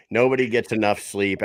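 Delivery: usual speaking voice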